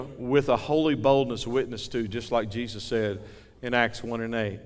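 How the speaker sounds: ordinary talk, nothing marked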